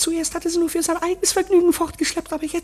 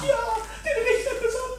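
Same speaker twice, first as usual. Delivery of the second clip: laughing; 8 semitones higher, 5 dB quieter, faster than usual. Acted. Laughing about the judge.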